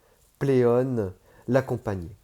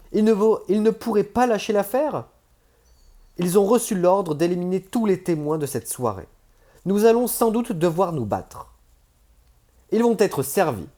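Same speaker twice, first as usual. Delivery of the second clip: urgent, higher, louder voice of a companion on the run; tense companion; 9 semitones higher, 6 dB louder than usual. Acted. A companion warns Page about pursuers, tense and urgent. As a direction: press on, ready for a fight. Companion urges flight, ready to fight.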